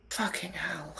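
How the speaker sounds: whispered under breath; whispered curse. Real, not acted. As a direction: whisper it in disgust. Bad roll reaction.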